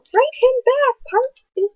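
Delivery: high-pitched, exasperated fangirl plea; worked-up squeal of frustration. Real, not acted. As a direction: beg a character, voice shooting way up. Pleading with book character.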